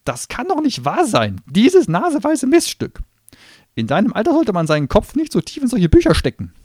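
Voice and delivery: very high, loud angry gnome voice; furious gnome. Acted. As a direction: rage at the clever girl.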